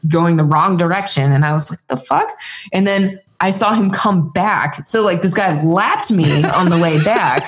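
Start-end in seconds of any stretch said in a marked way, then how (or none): 1.9-2.3 high, stunned disbelief; shocked disbelief. Retold or imitated. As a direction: blurt it out in shock. Shocked reaction to a passing runner.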